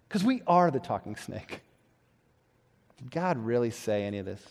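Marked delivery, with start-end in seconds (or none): 3.0-4.4 sly serpent whisper. Acted. Voicing the tempting serpent.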